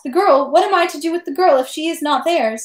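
a hint of fretting, pitch lifted throughout; anxious woman. A narrator's reading. Pockmarked woman left holding the girl, lightly voiced worry.

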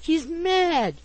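high-pitched, pained exclamation; agonized cry. A narrator's reading. Pickwick complains of the boy attacking him, agonized. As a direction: protest in agony. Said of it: "said the agonized Mister Pickwick".

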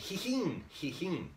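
high "hihin" that drops away; whinny the word like a horse; high-pitched horse imitation; Japanese horse whinny. Shown as a demonstration. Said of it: "Neigh, Japanese".

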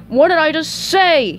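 loud, high annoyed bark; Squidward barking. Acted. Annoyed cashier at the order window, loud bark. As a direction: snap it loudly, pitch jumping up. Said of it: "he barked".

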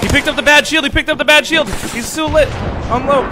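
high, loud combat shout; excited, urgent yelling; urgent callout. Real, not acted. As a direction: yell it to a teammate mid-fight.

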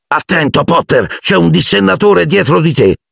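high-pitched taunting warning; mock alarm. A narrator's reading. Malfoy taunts Potter about a dementor, mock alarm. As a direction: squeal a fake warning to tease.

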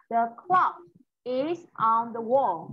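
slow, careful "is on the wall" with a falling "wall"; slow model reading. Shown as a demonstration. Teacher models the sentence slowly for her pupil. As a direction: read it slowly for a child to copy. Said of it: "Đọc lại".

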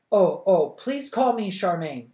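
put-on shy girl correcting the princess, stammering; stammered. A narrator's reading. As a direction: stumble over the words. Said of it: "Charmaine stammered".

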